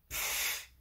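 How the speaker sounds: unvoiced dismissive snort; a child's scornful puff of air. Theo at his parents, scornful snort. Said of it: "schnaubt Theo".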